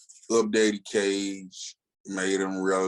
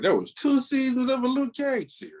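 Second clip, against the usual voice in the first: high, incredulous exclaim; amazed disbelief. Real, not acted. Fan marvels to cohost that the show existed, high and amazed. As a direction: still can't believe it happened.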